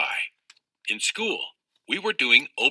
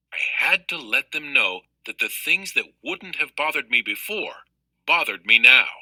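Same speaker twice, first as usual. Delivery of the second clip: usual speaking voice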